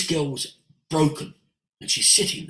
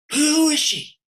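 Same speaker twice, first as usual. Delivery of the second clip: high, loud, awestruck question; urgent demand; 13 semitones higher, 6 dB louder, slower than usual. Retold or imitated. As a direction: insist on knowing, as the smitten friend. Acts friend demanding who she is.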